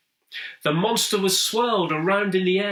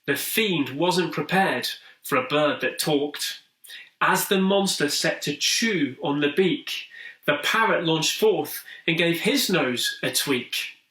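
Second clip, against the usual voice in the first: plain reading voice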